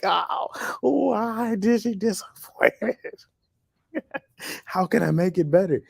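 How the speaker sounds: high, drawn-out mock lament; hammed-up wail of self-pity, slow and high; mock anguish. Host mocks fretting at home over her disappointment, fake anguish.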